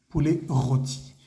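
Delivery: Poulet rôti slow, no final t; slow French model. Shown as a demonstration. Teacher models poulet rôti for the class, slowly. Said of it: "mucha atención a la pronunciación".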